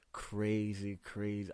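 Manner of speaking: ordinary talk, nothing marked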